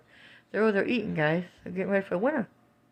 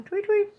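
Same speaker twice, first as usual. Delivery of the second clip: chirping. Pretend.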